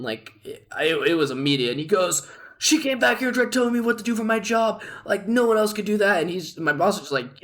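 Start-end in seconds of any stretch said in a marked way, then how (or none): 2.6-6.2 higher-pitched retold outburst; raised angry voice. Head chef complaining to the boss about the waitress, mock angry outburst. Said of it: "immediately just raised his voice to ten".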